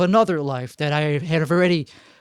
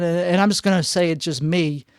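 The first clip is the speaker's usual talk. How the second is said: usual speaking voice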